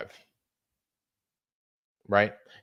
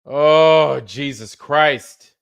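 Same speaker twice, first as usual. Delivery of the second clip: exasperated outcry; 3 semitones higher, 8 dB louder, much slower than usual. Real, not acted. Exasperated outburst.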